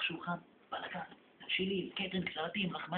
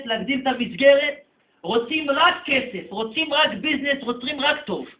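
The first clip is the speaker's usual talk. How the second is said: higher and louder, listing the wants; mock demanding; 6 semitones higher, 12 dB louder than usual. The congregation asks God for riches, mocking and loud. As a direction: rattle off greedy demands.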